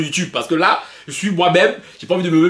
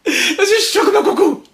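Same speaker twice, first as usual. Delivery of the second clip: much higher, slower "Je suis chaud comme un coucou" with an odd put-on voice; high mock cry; 11 semitones higher than usual. Shown as a demonstration. Vlogger hamming up his heated mood to viewers, high mock cry.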